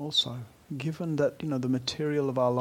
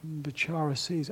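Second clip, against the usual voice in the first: ordinary delivery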